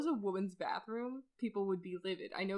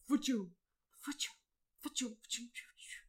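mock blaster noises; 4 semitones higher than usual. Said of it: "And she's like".

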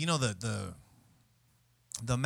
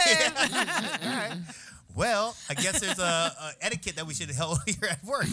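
much higher, laughing through it; laughing mock concession; 6 semitones higher, 4 dB louder, slower than usual. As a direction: give in while cracking up. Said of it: "I was like".

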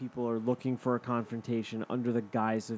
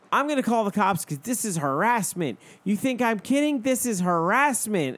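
higher, louder hammed-up outrage; mock outrage; 8 semitones higher, 5 dB louder than usual. The man claiming harassment, mock outrage. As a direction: overplay the indignant man. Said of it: "the guy was like".

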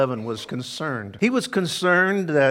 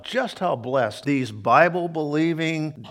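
normal talking voice

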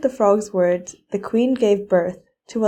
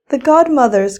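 king's higher, louder call of welcome; joyful cry; 4 semitones higher, 6 dB louder than usual. A narrator's reading. King greeting the arriving fairies, glad loud cry. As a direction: cry out gladly as the guests arrive. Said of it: "cried the king".

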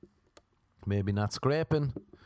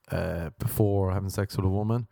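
usual speaking voice

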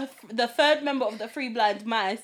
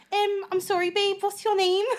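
high-pitched, hammed-up Scouse accent; Scouse chat-up in a high mocking voice; strong Scouse accent; 9 semitones higher, slower than usual. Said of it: "her accent was so strong".

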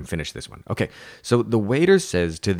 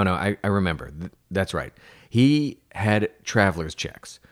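usual speaking voice

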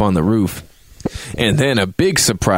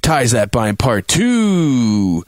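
slow, grand movie trailer announcer; dramatic trailer voice; 4 semitones higher, much slower than usual. Pretend. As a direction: announce it like an epic title. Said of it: "the dramatic conclusion".

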